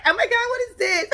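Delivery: high squeaky mock surprise; surprised gasp. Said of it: "So she was like".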